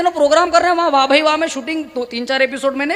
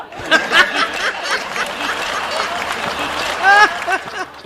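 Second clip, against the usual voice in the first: laughter; 6 semitones higher than usual. Real, not acted. Audience laughing at the old man's retort, long laugh. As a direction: burst of laughter after the punchline. Crowd laughter after punchline.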